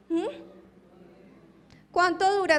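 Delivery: ordinary delivery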